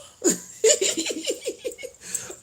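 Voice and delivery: high giggling laughter; real tickled laugh. Real, not acted. Laughing at neighbor's wrong accent guess, high real giggle. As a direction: crack up in a long, high giggle.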